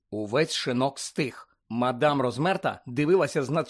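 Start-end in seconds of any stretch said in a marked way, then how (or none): none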